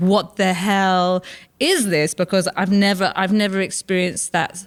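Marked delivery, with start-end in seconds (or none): 0.1-2.1 slow, stunned disbelief; drawn-out baffled reaction; bewildered aside. Retold or imitated. Guest performer shocked by a competitive stage, slow bewildered. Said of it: "you go".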